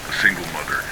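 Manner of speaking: usual speaking voice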